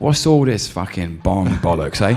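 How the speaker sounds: slow, loud London geezer impression; Idris Elba. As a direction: grumble in a London accent with laughter underneath. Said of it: "Can you do Idris?".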